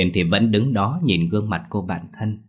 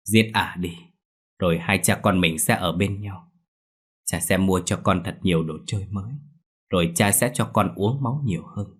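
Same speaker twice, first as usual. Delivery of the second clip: cold sneer. Acted. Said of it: "Ông Hoàng cười lạnh".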